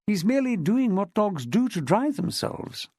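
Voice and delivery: put-on lightly posh English public school accent. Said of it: "in an accent redolent of one of the better public schools".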